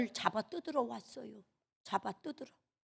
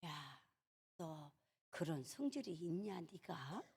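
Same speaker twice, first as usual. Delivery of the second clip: very soft, low murmur to oneself; hushed, low inner voice; quiet self-reproach; 6 semitones lower, 18 dB quieter, slower than usual. Retold or imitated. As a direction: muse under your breath in disbelief. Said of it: "그러고는 가만히".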